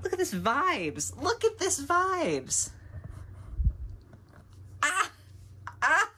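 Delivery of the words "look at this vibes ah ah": much higher, slow cooing squeal; slow, high gushing coo; squealing delight. Real, not acted. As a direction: squeal it like something adorable. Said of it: "repeated admiring exclamation".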